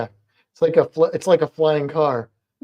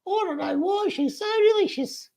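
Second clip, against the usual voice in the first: put-on much higher squeaky baby voice; cute pet voice; 4 dB quieter than usual. Owner cooing over the lizard in a much higher voice. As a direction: coo it up high like a baby.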